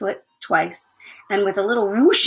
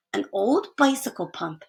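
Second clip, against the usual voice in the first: ordinary delivery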